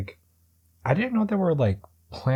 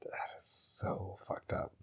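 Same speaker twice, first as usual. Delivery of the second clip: whisper; 14 dB quieter, slower than usual. Real, not acted. Shocked reaction to the story.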